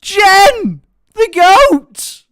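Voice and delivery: loud, high shouts of greeting; thrilled yelling to welcome Jen; excited shouting. Real, not acted. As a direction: cheer her name across the room. Greeting mum in the chat.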